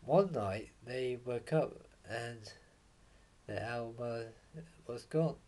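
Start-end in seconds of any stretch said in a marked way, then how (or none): none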